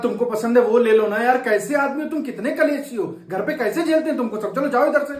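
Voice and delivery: angry scolding. Acted. Beggar scolding a stingy driver, fed up and loud.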